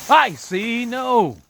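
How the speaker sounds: sudden high alarmed yelp; startled cry. Real, not acted. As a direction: jump in alarm.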